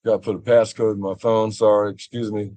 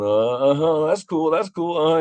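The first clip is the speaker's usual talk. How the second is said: high, placating mock agreement; pitched-up, half-following "uh-huh" tone; humoring listeners; 6 semitones higher than usual. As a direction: imitate people nodding along to a child. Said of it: "everyone else is just going".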